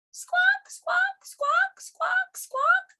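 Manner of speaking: very high, squeaky gull squawks; seagull squawking. Acted. Story's seagulls answer.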